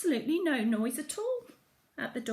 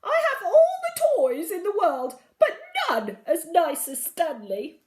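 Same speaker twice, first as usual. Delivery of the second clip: high, loud wailing sob; silly queen crying; 7 semitones higher, 8 dB louder than usual. A narrator's reading. Silly queen crying to Emily Brown, high and wailing. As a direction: wail it like a spoilt child. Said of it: "That silly queen started crying harder than ever".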